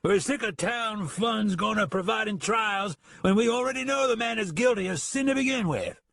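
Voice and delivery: put-on drunken shout. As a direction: shout it to the mob. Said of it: "Kearns shouted".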